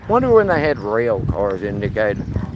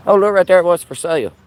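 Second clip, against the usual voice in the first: excited discovery; 9 semitones higher, 5 dB louder than usual. Real, not acted. Spots something in library.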